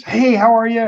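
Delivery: lightly acted high, lifted hello; retold cheery greeting. Shop woman hailing customers who walk in, cheery.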